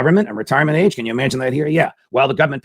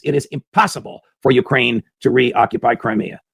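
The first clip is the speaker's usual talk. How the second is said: ordinary talk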